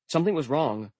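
plain reading voice

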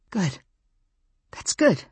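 croaked. A narrator's reading. Narrator relieved the wolf wants to live, croaking. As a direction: squeeze the words out as a croak. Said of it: "I croaked".

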